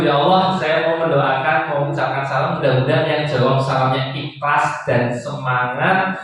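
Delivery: earnest prayer cadence, lightly done; prayer leading. Acted. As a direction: lead the room in a blessing. Leads a prayer for the audience.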